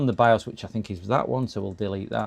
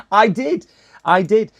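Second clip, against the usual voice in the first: higher, louder insistence on "did"; emphatic protest; 9 semitones higher, 10 dB louder, slower than usual. Real, not acted. Streamer protesting they did want to try at school, emphatic. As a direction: hit each "did" hard, "I DID".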